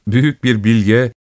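plain reading voice

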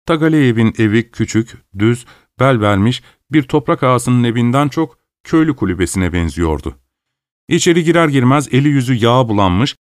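plain reading voice

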